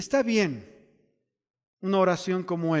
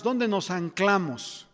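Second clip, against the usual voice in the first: ordinary delivery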